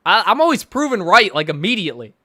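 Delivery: ordinary talk, nothing marked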